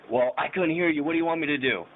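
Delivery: high retold backtalk; smart-mouthed. Drunk suspect answering cops, high smart-mouthed voice. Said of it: "I'm still getting smart with them".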